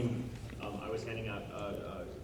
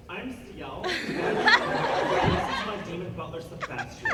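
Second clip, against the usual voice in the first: put-on haughty kid attitude; 7 semitones higher, much slower than usual. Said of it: "the attitude they gave me".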